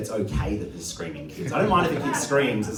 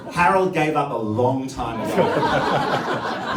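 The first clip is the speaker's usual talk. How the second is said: ordinary talk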